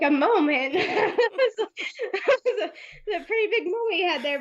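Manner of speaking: laughing, half asking; amused disbelief. Retold or imitated. Seller mocking the customer's "moment" while laughing. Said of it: "I'm like".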